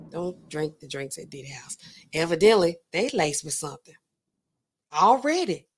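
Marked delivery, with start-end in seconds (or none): 0.1-1.8 hushed, confidential whisper; whispered warning. Real, not acted. Warning listeners about drinks at [name]'s house, whispered warning. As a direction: whisper it like a secret caution.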